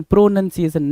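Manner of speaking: प्रोनंसिएशन with short "nun" in the middle; correct pronunciation. Shown as a demonstration. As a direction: say प्रोनंसिएशन as "pro-NUN-ciation". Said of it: "नन है ना, N यू N है".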